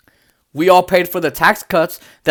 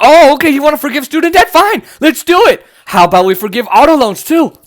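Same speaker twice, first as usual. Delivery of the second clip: mock pundit; 6 semitones higher, 4 dB louder than usual. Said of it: "I love how he's like".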